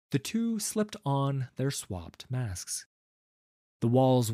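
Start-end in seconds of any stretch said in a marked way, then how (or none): none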